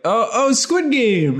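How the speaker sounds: high, drawn-out mock excitement; excited recognition. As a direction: imitate a stranger delightedly spotting the costume. Retold stranger's excited recognition.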